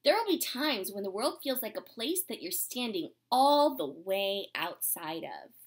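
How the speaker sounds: gentle, unhurried storybook reading; reading to children. Acted. Teacher reading a storybook to her class, gentle and unhurried. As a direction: read it like a picture book to the class.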